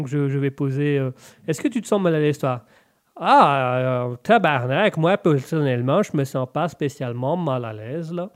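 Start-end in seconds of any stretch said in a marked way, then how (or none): 3.2-8.3 put-on French-Canadian accent; mock Québécois accent, settling lower. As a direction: answer as a comic Québécois. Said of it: "mon accent est très bien".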